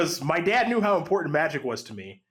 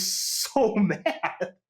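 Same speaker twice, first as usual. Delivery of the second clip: drawn-out SO, breaking into a laugh; laughing through the punchline; amused emphasis; 5 semitones higher than usual. Real, not acted. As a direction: stretch "so" slowly, laughing. Laughing at remembered angry opponent.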